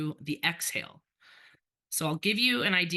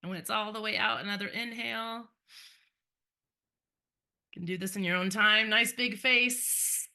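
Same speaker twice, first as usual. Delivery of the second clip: guided breathing. Acted. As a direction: lead the class gently through breaths.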